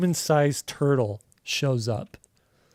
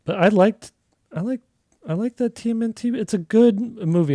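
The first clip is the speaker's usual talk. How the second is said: excited gush; 8 semitones higher, 4 dB louder than usual. Real, not acted. Gushing about the TMNT movie.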